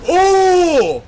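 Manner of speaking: high loud amazed cry; amazed shout. Real, not acted. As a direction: gasp out in awe. Amazed at hero.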